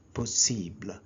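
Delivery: "Possible" said low as po-SEEBL; French "Possible" with a long ee and falling end; French pronunciation. Shown as a demonstration. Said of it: "in French it is pronounced as".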